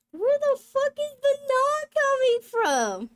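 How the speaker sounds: high, loud incredulous protest; can't believe the nah; outraged laughing disbelief; exasperated disbelief. Real, not acted. Exasperated at pronunciation.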